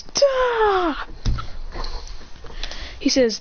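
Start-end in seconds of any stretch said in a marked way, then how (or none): 0.0-0.9 a sharp high effort shout; high grunt. Retold or imitated. Villain takes a hit, high effort grunt. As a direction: bark it up high. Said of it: "He goes".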